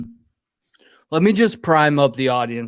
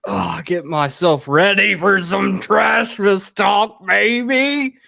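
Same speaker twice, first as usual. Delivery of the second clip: put-on slow, higher drawling good-ol'-boy voice; 7 semitones higher, 5 dB louder, slower than usual. Dons a redneck veteran persona.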